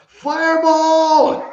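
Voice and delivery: long, high, loud battle cry; yelled spell cast. Acted. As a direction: draw it out in a big high yell. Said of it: "and he yells".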